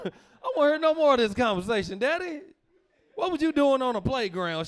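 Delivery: loud, high scolding; outraged. Retold or imitated. Son scolding daddy about the playground, loud and high. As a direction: scold him loudly and high.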